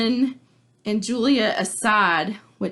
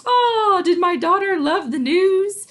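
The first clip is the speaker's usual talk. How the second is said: much higher, louder, beaming dad; cheery father; 11 semitones higher, 5 dB louder than usual. Retold or imitated. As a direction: burst in full of good cheer. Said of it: "Capulet walks in and he's like".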